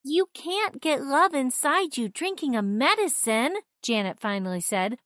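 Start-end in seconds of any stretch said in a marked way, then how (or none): 0.0-3.6 put-on firm high girl voice. A narrator's reading. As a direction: set her straight firmly.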